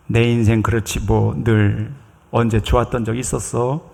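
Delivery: low, soft resignation; discouraged. Acted. Low-self-esteem person after being scolded, discouraged. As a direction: give up quietly, voice dropped and subdued. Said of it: "첫 번째, 좌절합니다".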